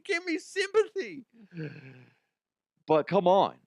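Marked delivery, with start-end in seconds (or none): 0.0-1.2 very high, squeezed protest cry; whiny mock demand, pitched way up; mock protester. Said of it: "you know, protesting".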